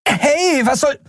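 very high, cut-off outburst; indignant yelp as she is shoved aside; startled protest. Acted. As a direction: pilot protesting being grabbed. Endriel pulled from the wheel.

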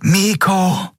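high, sharp scream of his name. A narrator's reading. Captain sees Miko fall, high scream of his name. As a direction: cry out in alarm. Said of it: "Kapitän Naguns Schrei".

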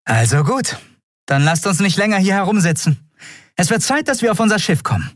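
high, brisk call to set off; rally the crew in a high voice; rousing captain. A narrator's reading. (aufmunternd, mit hoher Stimme)

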